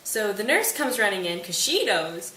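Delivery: usual speaking voice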